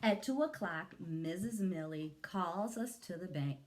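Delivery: plain reading voice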